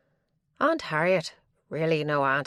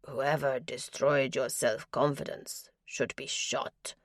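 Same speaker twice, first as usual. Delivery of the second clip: lower, slow, softer foreign-edged intensity; low and passionate; 4 semitones lower, 4 dB quieter, slower than usual. A narrator's reading. Norwegian skipper tells the lonely woman, low and passionate. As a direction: say it low, meaning every word. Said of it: "His voice was low and passionate".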